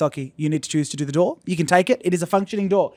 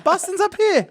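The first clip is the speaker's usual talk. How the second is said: "Boston's up here" pitched way up; squeaky high insistence; slower than usual. Shown as a demonstration. Correcting co-hosts about where Boston is, squeaky high. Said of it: "pointing Boston on a map".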